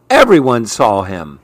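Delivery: ordinary delivery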